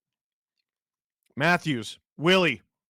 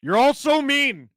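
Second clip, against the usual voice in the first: whiny; 10 semitones higher, 8 dB louder than usual. Pretend. Whines at chat.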